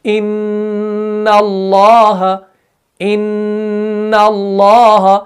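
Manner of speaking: इन्नल्लाह slow, the nn held long and humming through the nose; drawn-out ghunna. Shown as a demonstration. Teacher demonstrating nasal ghunna for students. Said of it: "अपनी ज़ुबान पर ज़रा गौर करें".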